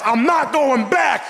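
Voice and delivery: high, slow tough-guy line; hammed-up fugitive vow; mock dramatic vow. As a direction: drag it out like a movie fugitive. Dramatic cop-fear vow.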